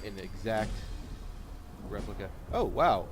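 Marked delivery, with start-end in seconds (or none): 2.5-3.1 surprised. Real, not acted. Sudden level-up surprise.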